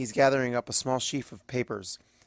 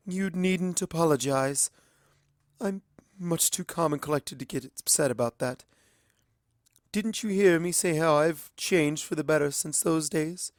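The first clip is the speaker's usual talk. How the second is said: coolly sarcastic, bitterness underneath; bitter sarcasm; 6 semitones higher than usual. A narrator's reading. Luna answering Twilight with bitter sarcasm. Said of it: "undercurrent of bitter sarcasm".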